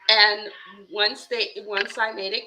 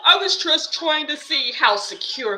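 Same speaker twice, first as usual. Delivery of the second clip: congressman's mock excuse; 5 semitones higher, 7 dB louder than usual. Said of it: "his excuse became".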